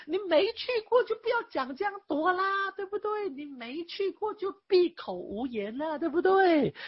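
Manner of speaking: high, taunting put-down; scornful retort. Acted. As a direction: needle the one who never went. Scolding an imagined critic. (高声嘲讽地反驳)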